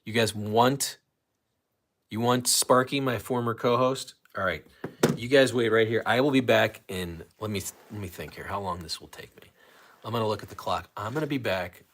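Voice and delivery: ordinary talk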